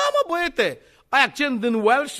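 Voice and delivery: high, loud teasing retort; mock exclamation. Preacher acting a retort to the offended passenger, loud mock exclamation.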